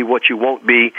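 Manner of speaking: ordinary talk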